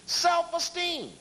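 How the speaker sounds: self-esteem said high and mocking, stretched slow; a high, scornful self-esteem; mocking echo. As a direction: sneer the word self-esteem back. Mocks memo's repeated phrase.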